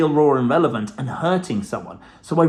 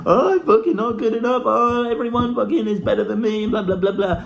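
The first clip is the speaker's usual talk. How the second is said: higher, whiny mimicry of a self-pitying partner; mock whinging partner; 6 semitones higher than usual. Mimicking his upset partner after a failed interview, mock whining. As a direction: lampoon her moaning in a put-on voice. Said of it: "she was whinging".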